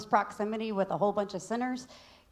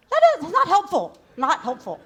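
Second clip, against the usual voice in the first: high, loud laughing exasperation; exasperated; 11 semitones higher, 13 dB louder than usual. Real, not acted. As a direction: cry out it's no help. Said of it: "laughing, exasperated".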